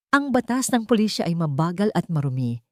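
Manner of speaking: ordinary delivery, nothing marked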